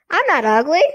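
high, loud, squeaky dummy voice; Slappy. A narrator's reading. Dummy objects to being called ugly, squeaky and loud. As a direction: protest like an offended puppet. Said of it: "Slappy said in Lindy's squeaky voice".

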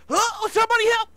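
very high, loud panicked shriek; mock scream for help. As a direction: scream for rescue in hammed-up terror. Acted cry for help when slammed.

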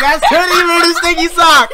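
high laughing shout; excited shout. Real, not acted. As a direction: yell it out laughing. Reading the gross bean card.

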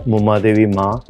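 ordinary delivery

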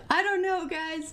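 high, loud, panicky reluctance; frightened protest. Real, not acted. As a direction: plead with friends, voice jumping up. Frightened, reluctant to continue game.

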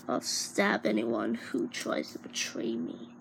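unvoiced menacing whisper; whispered threat. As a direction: breathe the threat out softly.

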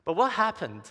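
ordinary talk

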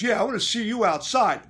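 loud, high challenge to fight; imitated threat. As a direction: call him out, mimicking the aggressor. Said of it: "He goes".

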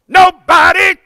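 Nobody growled out, high and loud. Shown as a demonstration. Showing the crowd how to growl their line. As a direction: growl it from the chest. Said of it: "I want you to growl it".

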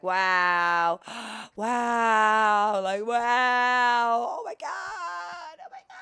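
over-the-top wows, building to a squeak; mock awestruck gasping. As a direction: gush like a starstruck fan. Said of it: "this is going to be me the entire level".

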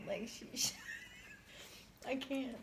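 breath gone, whispered through laughing; helpless laughter. Real, not acted. Can't imitate her accent, helpless laughing whisper. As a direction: barely get it out, voiceless with laughter.

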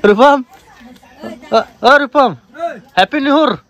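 high, loud playful call to a friend; excited calling out. Real, not acted. Calling a friend by name to wish him, high and excited. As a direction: sing out his name, then the wish. Calling Rupam for new year.

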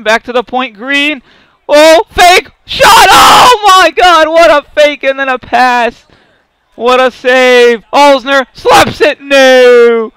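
high, loud frantic yelling, some words dragged slow; high, loud hockey-gamer screams over a wild scramble; excited shouting. Real, not acted. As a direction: scream the chance like the game hangs on it. Frantic scoring chance in game.

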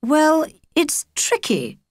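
high, slow, reedy nervous father; father hedging, reedy and thin. A narrator's reading. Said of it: "Favre's voice had gone reedy and thin".

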